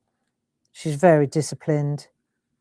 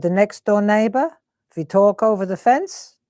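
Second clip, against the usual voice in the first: higher, imitated German mum; mum's German-accented chat, pitched up a little; 3 semitones higher than usual. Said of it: "and she's German, isn't she".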